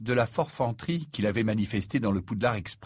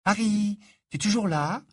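put-on higher, questioning girl student voice; eager Hermione; 11 semitones higher than usual. Hermione checks Harry is still listening, high questioning voice. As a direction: call your friend back to attention, pitched high.

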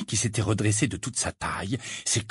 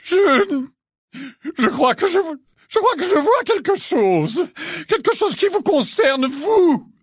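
very high, slow mystic voice trembling with anger; a seer's trance voice she can't keep steady; ethereal tone shaken by anger; 5 dB louder, slower than usual. A narrator's reading. Trelawney prophesying at Umbridge, mystic voice trembling with anger. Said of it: "reprendre son habituel ton éthéré ... la colère qui faisait trembler sa voix".

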